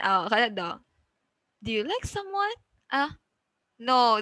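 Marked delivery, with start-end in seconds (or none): 1.6-2.5 much higher, "someone" left up; rising intonation. Shown as a demonstration. Teacher gives a yes-no example, rising intonation. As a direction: climb to a high ending on "someone".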